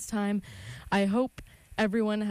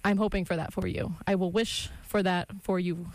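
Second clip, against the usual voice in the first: usual speaking voice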